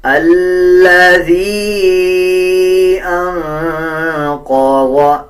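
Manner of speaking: अल्लज़ी with the final ई held for three beats; slow, high, drawn-out chant; long vowel. Shown as a demonstration. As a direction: stretch the ई of अल्लज़ी slowly. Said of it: "इसको three second लंबा करेंगे".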